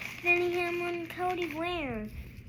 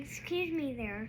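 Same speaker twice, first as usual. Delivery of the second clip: plain reading voice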